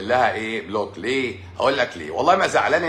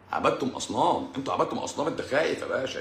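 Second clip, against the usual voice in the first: normal talking voice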